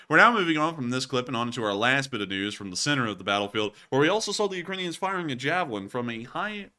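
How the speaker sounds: ordinary talk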